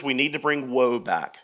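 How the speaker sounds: ordinary delivery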